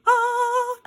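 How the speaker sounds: high, loud delighted squeal; high-pitched "ah" of real excitement; excited squeal. Real, not acted. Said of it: "I was so excited".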